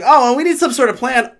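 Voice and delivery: high, loud mocking whine; mocking the girl. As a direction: parrot her high and snotty. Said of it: "She's like".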